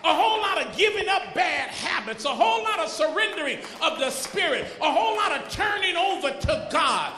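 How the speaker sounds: loud, ringing sermon cadence, hitting each line hard; full-force preaching. Acted. Pastor rousing the church about Easter, full-force preaching. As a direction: preach it out and build the fire.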